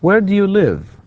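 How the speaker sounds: high "Where do you", sharp drop on "live"; falling intonation. Shown as a demonstration. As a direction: let "live" fall right down. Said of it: "it's falling intonation".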